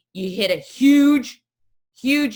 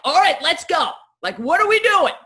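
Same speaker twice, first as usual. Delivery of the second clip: higher, louder rallying call; rally everyone into action; bossy go-getter; 9 semitones higher, 6 dB louder than usual. Retold or imitated. Oldest sister Lisa rallying the family, high loud go-getter call. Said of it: "she's like".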